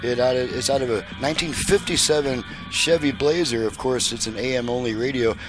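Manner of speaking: usual speaking voice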